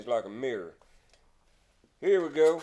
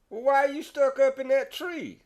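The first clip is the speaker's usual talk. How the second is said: much higher incredulous question; surprised question; 11 semitones higher than usual. Acted. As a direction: ask it in disbelief. Joe voiced asking the neighbor.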